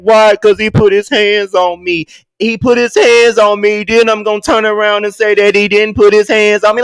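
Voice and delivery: a loud, whiny parody of her; hammed-up complaining girl; mock victim rant. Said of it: "she get her ass online".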